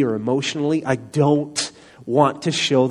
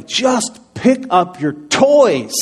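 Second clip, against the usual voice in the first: slow, high, loud outburst; mad dad yell; 9 semitones higher, 8 dB louder, much slower than usual. Retold or imitated. Dad finally loses his temper at his boys, mad yell. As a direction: finally blow up, stretching out each word. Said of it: "I just start getting mad".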